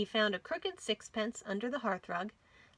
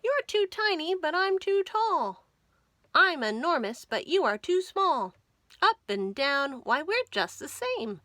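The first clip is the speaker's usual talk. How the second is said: high, loud playful mouse voices; 6 semitones higher, 6 dB louder than usual. A narrator's reading. Two mice on a seesaw bickering, high and loud. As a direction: bounce the lines like two mice arguing. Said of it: "said Thingamajig to What's-His-Name".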